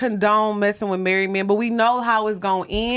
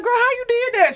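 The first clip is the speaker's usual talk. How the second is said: pitched way up, asking in disbelief; high surprised girl voice; 11 semitones higher than usual. Retold or imitated. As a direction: squeal the question to a friend. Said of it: "I'm like".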